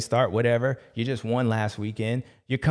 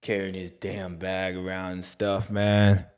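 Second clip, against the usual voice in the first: a hint of a lower, worn-out guy, lazy complaining; lightly voiced tired character; 3 semitones lower than usual. The caddy complains about Jay, a lower lazy grumble.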